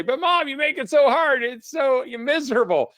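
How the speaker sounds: put-on high, loud whiny child complaining to mom; whining kid. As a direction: whine like a kid who thinks mom is too hard. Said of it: "whenever your kids say".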